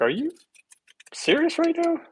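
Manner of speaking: floored by a friend's claim; incredulous. Retold or imitated. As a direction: pitch leaping high on "serious". Answering dismissive doctor friend.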